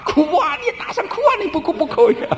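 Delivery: extremely high-pitched mock pleading; hammed-up squeal of urgency, close to laughing; anxious mother-in-law. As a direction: imitate a frantic mother-in-law urging tears. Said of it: "着急".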